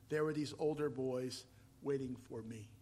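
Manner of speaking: normal talking voice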